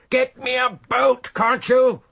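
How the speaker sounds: high, loud, slow demanding yell. A narrator's reading. Rashleigh yelling at the men on the quay for a boat. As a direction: bellow for a boat. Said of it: "yelled Rashleigh".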